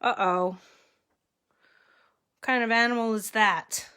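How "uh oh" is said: plain reading voice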